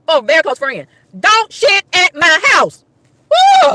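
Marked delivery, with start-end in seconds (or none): 1.2-2.8 slow, high, word-by-word command; emphatic warning. Real, not acted. Woman warning family and friends, emphatic. 3.3-3.7 very high, loud whoop; exasperated woo. Real, not acted. Woman venting about bathroom guest, exasperated woo.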